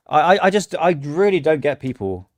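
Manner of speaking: ordinary delivery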